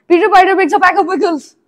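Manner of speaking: high loud rushed twister; tongue twister speeding up. Shown as a demonstration. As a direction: rattle it off fast and loud. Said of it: "really fast".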